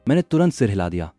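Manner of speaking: ordinary delivery, nothing marked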